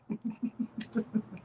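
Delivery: quiet laugh at own joke; soft chuckle. Real, not acted. Host amused at telling viewers to Google it, soft chuckle.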